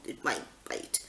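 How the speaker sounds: hushed whisper; whispered fear. Retold or imitated. Mum whispering her fear while holding the spider. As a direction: whisper it as if the spider might hear. Fearful aside about spider.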